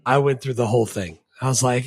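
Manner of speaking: ordinary delivery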